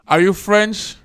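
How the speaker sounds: loud, high mock interrogation; mock president. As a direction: probe them like a greedy official. President voiced in mock scene.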